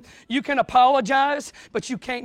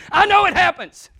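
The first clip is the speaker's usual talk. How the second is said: ordinary talk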